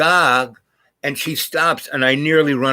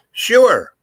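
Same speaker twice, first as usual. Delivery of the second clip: high, loud one-word yes; acted-out eager reply, pitched way up; emphatic acceptance; 9 semitones higher, 6 dB louder than usual. Acted reply to dinner invitation.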